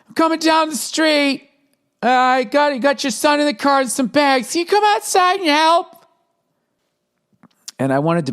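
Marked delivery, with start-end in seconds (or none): 0.1-6.1 high, sweet mock-wife voice; a chirpy put-on wife asking a favour; husband mimics his wife's cheery request on the phone, high and sing-song; imitating his wife. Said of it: "She goes".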